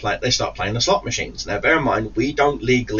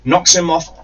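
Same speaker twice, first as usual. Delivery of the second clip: normal talking voice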